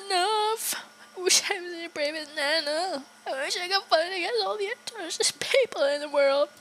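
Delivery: put-on high, crying boy's voice; a broken child at the end of his rope; tearful Stewie. Streamer voicing Stewie pleading through tears.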